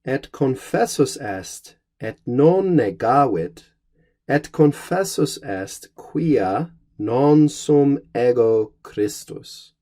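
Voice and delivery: slow, deliberate recitation of the Latin verse; slow Latin reading. Acted. Teacher reading the verse to his students, slow and measured recitation. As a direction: read the Vulgate line slowly and solemnly.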